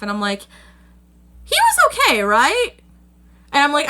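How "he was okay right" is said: very high, anxious little squeak; worried squeak. Retold or imitated. Fan retelling her panic about the character, very high worried squeak. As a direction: squeak it out like you suddenly doubt it.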